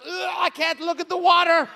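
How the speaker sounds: loud, high sick complaint; mock queasy groan. Comic wife on the boat, mock queasy and loud. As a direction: moan it like you're about to barf. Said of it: "She's like".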